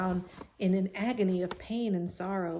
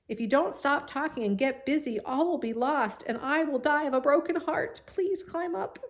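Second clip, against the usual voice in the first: high, loud desperate plea; 8 semitones higher, 4 dB louder than usual. A narrator's reading. Wilbur begging the lazy rat, high desperate plea. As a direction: beg in desperation. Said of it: "said Wilbur in desperation".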